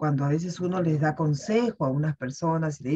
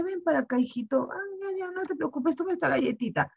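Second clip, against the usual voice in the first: put-on soothing a child; 11 semitones higher, 4 dB quieter than usual.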